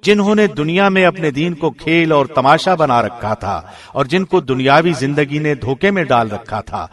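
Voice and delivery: ordinary delivery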